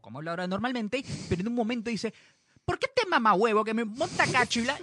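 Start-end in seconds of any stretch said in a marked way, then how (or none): 2.6-4.8 fast, heated Venezuelan slang burst; imitating Venezuelan outburst. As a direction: blurt it like an excited caraqueña. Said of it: "hablando así como muy acelerado y con mucha emoción". (imitación de arrebato venezolano, rápido y acalorado)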